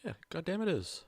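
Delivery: sudden high, loud burst; exasperated outburst. Acted. Cop insists to the witness.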